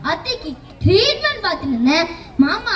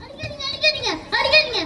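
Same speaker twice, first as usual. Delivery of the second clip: mock begging; 6 semitones higher, 5 dB quieter, faster than usual. Pleading while being beaten.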